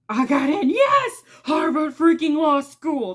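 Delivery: high, loud burst of joy with a near-shriek on yes; excited cheer. Retold or imitated. Students opening letters, thrilled, cheering at getting in. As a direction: imitate students thrilled by their acceptance letters. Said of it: "So they get excited".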